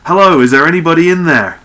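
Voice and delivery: high, loud hailing call; shout. Acted. Captain demonstrating the call into space, shouting. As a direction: holler as if into a great gateway. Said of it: "we're going to shout".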